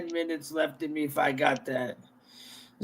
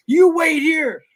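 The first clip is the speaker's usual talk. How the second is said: mock-stern, higher-pitched cop voice; retold cop order; 7 semitones higher, 4 dB louder than usual. Off-duty cop ordering the kids to wait, mock stern. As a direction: imitate a cop laying down orders.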